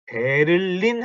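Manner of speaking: "베를린" in three even Korean syllables; "베를린" with a full middle syllable 를; Korean-style reading. Shown as a demonstration. Teacher mimics learners' mistake, "베를린" said syllable by syllable in Korean sounds. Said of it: "제발 베를린 하지 마시고".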